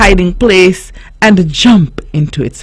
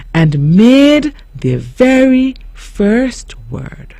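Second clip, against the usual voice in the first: slow dramatic reveal; 3 semitones higher, slower than usual. A narrator's reading. Story climax, slow.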